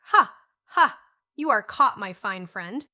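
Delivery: loud gloating pirate shout; shouted taunt. A narrator's reading. Pirate leader shouting across water at the doctor, gloating. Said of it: "shook his fist at the doctor and shouted across the water".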